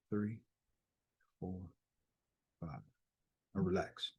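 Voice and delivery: quiet guided breathing count. Acted. Leads breathing exercise with counting.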